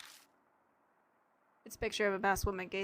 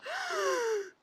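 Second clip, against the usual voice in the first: high, delighted mock gasp of flattery; dramatic gasp. Streamer gasps at chat's compliment, theatrically flattered. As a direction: gasp as if deeply touched. Flattered by chat calling her cool.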